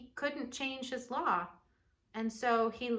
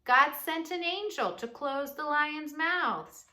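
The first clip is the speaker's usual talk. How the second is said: loud, higher happy call; shouting; 6 semitones higher, 7 dB louder than usual. Acted. Daniel shouting to the king from the den. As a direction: call it up with joy. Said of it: "Daniel shouted".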